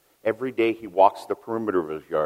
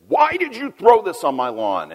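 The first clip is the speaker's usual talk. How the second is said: high, loud indignant yell; angry neighbor; 10 semitones higher, 6 dB louder than usual. Retold or imitated. Neighbor yells at the boy about his lawn, angry. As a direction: bark it like a furious old man. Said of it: "and says".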